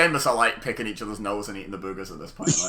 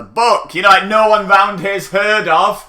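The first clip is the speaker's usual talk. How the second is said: exasperated; 7 semitones higher, 12 dB louder, slower than usual. Real, not acted. Exasperated about book.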